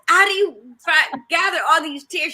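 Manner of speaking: loud, high-pitched incredulous question; hammed-up bafflement at someone; mock disbelief. Mimics own disbelief at crier.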